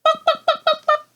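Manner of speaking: very high, loud imitated clucks; chicken clucking. Reader answering what a chicken says, high clucking. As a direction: cluck fast bursts like a hen. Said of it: "What does a chicken say?".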